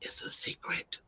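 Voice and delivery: playful whispered secret; mock-secretive whisper to viewers. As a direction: hush it like a shared secret. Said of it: "don't say a word".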